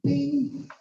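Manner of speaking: high imitated alert chime; little vocal ding; phone notification bing.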